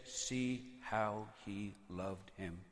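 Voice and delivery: very slow, low and soft; hushed sorrowful awe. Acted. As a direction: let each word sink in quietly.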